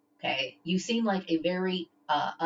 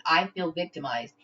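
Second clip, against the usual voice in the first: normal talking voice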